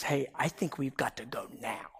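low, hushed imitated wife; quiet urgency. Wife telling her husband it is time, hushed. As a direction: say it low and soft, understated.

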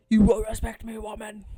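a hint of a domineering boyfriend, loud on the first words; hammed-up demand, burst of volume; mock erratic man. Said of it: "gets erratic and is like".

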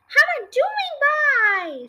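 high, drawn-out call; loud, stretched holler from above; shouting. A narrator's reading. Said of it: "she shouted".

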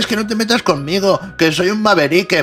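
mock indignation. Mocked person defending being a maverique, mock indignation. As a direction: pitch it up, mocking the protest. Mocks someone claiming special identity.

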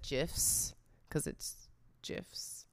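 "gifs" with a J sound, like "jifs"; soft G. Shown as a demonstration. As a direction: say "gifs" starting like "Jiffy". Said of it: "I just always said".

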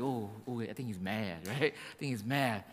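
quiet mock worry that the boss is mad; nervous aside. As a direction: whisper it like you're scared he'll hear. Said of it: "you get kinda nervous".

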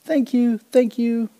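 gleeful exclaim of thanks. Acted. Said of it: "Kancil exclaimed".

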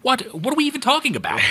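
very high, fast incredulous squeak; hammed-up bewildered outburst; mock bafflement. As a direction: shoot up high in exaggerated disbelief. Acted bafflement at film's dialogue.

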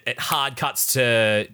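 usual speaking voice

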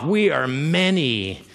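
slow, low threatening demon voice; menacing demon. Retold or imitated. Demon intimidating Jesus, slow menacing voice. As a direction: draw it out to scare him. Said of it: "trying to intimidate Jesus".

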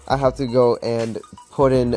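ordinary talk

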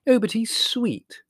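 put-on high, slow, doting girl voice; Margot cooing. A narrator's reading. Margot defending the puppy to Larry, high and doting. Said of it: "repeated Margot".

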